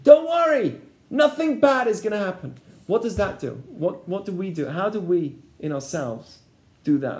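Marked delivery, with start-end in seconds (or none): 0.0-2.5 higher, louder coaxing tempter; the tempter says it again, hammed-up reassurance; snake coaxing. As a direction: brush the worry away, sweetly. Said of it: "This is the next, next statement".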